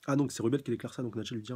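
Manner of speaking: usual speaking voice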